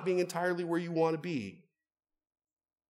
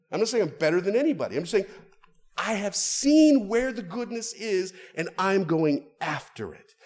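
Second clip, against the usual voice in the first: louder, faster, building to a passionate peak; rising preaching climax; 6 semitones higher, 5 dB louder than usual. Real, not acted. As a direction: drive it home with rising fervour. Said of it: "preaching climax".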